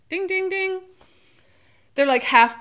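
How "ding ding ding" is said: high sing-song bell dings; playful chime of a right guess; game-show ding. Pretend. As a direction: ring it out like a quiz-show correct-answer bell. Guessing the twist, game-show answer.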